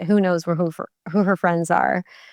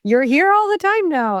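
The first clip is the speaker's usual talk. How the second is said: quoted trainer, pitched up high; trainer's cheery remark; 10 semitones higher, 3 dB louder than usual. Retold or imitated. To a regular gym-goer, a trainer's pitched-up greeting. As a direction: toss it off like a chirpy gym trainer. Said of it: "my trainer at the gym is like".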